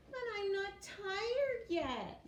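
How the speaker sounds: high, slow whiny protest; whiny child. Acted. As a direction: whine like a kid at bedtime.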